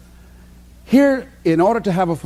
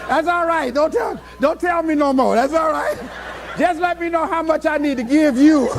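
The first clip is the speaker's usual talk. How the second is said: higher, laughing mock plea; 7 semitones higher than usual. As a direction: beg through laughter. Customer begs her to stop.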